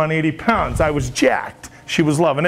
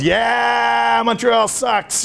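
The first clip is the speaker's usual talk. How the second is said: higher, slow shouted jeer; mock fan shout; 5 semitones higher, much slower than usual. Weatherman jeering Montreal for Boston fans, hammed-up shout. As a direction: bellow it like a rowdy fan in the stands.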